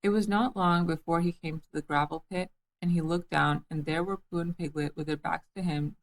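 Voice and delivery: usual speaking voice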